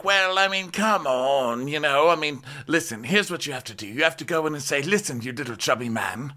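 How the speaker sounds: high, hammed-up pushy coaching voice, building to a shout; mock bossy pep talk. Friend coaching her before the meeting, hammed-up high bossy voice. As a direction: egg her on in a shrill put-on voice that ends shouting. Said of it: "So she's like".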